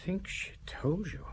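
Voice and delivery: a wounded whisper to himself; bitter whisper. Acted. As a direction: whisper it as if the words wound you. Secretary hurt by girl's betrayal.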